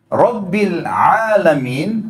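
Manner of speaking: slow "Rabbil Alamin" with a proper ain; correct ain. Shown as a demonstration. Teacher to congregation, reciting carefully and slowly. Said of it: "pakai hamzah".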